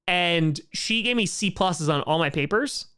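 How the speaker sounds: ordinary talk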